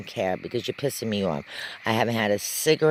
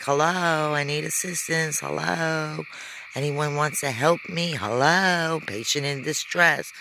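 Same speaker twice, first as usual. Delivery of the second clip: yelling for help; 6 semitones higher than usual. Retold or imitated. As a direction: call out for the nurse, pleading. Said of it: "I'm yelling in behind the door".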